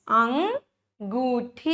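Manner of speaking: अंगूठी said very slowly, each syllable stretched; slow drawn-out word. Shown as a demonstration. Teacher slowly modelling a word for children. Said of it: "क्या है ये?".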